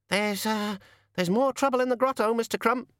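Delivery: very high, squeaky hesitant voice; timid pixie. Acted. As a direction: squeak the bad news to the boss. Timid pixie reports trouble to boss.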